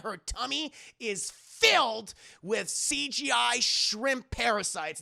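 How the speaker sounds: usual speaking voice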